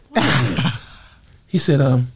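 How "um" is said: ordinary talk, nothing marked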